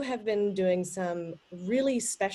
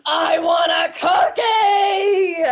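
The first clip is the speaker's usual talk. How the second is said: loud, higher, slow shout for a cookie; full-voiced child shout; 10 semitones higher, 10 dB louder, much slower than usual. Kid demanding cookies with full body and voice, loud shout. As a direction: yell it with your whole body like a kid. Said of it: "my full body and my full voice was".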